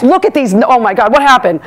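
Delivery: ordinary talk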